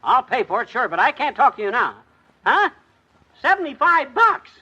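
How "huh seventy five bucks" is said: shocked. Acted. Shocked by the price on phone.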